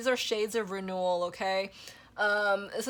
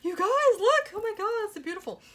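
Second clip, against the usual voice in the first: much higher, loud call to look, then a fast rush of wonder; excited awe; 12 semitones higher, 5 dB louder, faster than usual. Real, not acted. Reader shows viewers the card: high excited delight. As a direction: squeal it out to the viewers, thrilled.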